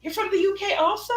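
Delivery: high, quick British surprise; put-on British accent, high questioning; imitated British girl. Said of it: "And the girl said".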